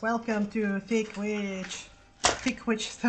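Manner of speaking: hammed-up game show intro; game show host. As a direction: welcome them like a cheesy game show host. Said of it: "that sounds like a game show".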